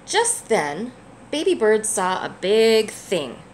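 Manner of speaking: gentle, lively picture-book read-aloud; reading to a child. A narrator's reading. Parent reading a picture book to a child, gently lively. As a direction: read it warmly for a little one.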